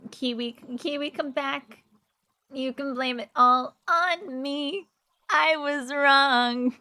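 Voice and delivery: hammed-up high begging plea; mock begging. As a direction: beg them to come back, hammy. Begging Kiwi to return.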